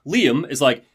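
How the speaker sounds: ordinary delivery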